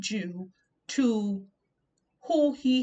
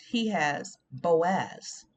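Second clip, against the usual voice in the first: slow emphatic point; 2 semitones lower, much slower than usual. Acted. Preacher drawing out a point.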